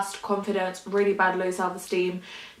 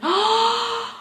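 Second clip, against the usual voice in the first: sharp, high gasp of wonder; sudden awed intake of breath; surprised gasp; 12 semitones higher than usual. Real, not acted. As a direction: gasp at the sight. Sees a real rainbow.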